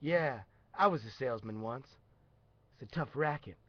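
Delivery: low, subdued ex-salesman; resigned old salesman, soft and low; washed-up salesman at the bar. Acted. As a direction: mumble it low like a beaten barfly. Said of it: "You're gonna be the guy at the bar".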